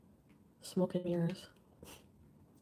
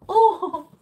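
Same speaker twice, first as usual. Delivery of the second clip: sudden high loud gasp; startled real exclamation; surprised oh; 10 semitones higher, 12 dB louder than usual. Real, not acted. Surprised reaction on stream.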